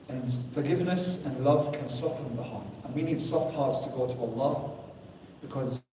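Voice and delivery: ordinary delivery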